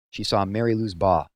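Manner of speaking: plain reading voice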